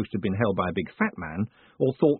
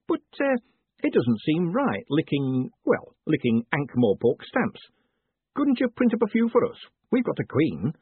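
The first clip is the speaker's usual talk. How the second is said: higher-pitched, halting mayor's voice; eager mayor, hesitant; 6 semitones higher than usual. Acted. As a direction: ease into an awkward request, lilting upward.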